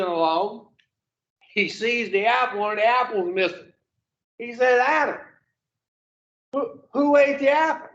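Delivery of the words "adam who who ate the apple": higher, startled demand as God; God shocked, pitched up in disbelief; shocked God, voice raised high. Acted. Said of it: "God was shocked".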